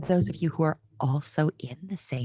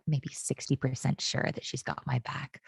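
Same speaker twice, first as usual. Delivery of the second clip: normal talking voice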